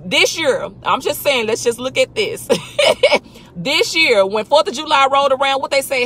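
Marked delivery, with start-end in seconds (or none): 2.5-3.2 a short high laugh. Real, not acted. Laughing in the middle of her point. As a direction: laugh it off quickly.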